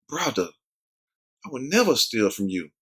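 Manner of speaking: soft, hushed innocent denial; straight-faced denial. Retold or imitated. Lying friend denying the pork chop, hushed and innocent. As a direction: lie calmly and softly to his face. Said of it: "with a straight face".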